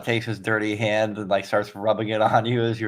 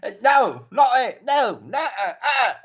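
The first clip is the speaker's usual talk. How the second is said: much higher and louder, laughing protest; high squeaky character voice; 12 semitones higher, 4 dB louder than usual. Acted. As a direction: whine up high while resisting. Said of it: "his accent changed".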